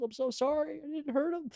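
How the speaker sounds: very high, mock-tearful apology; imitated bawling. Son imitating his mom's apology, high mock bawling. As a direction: squeak out an exaggerated sobbing sorry. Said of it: "Just bawling".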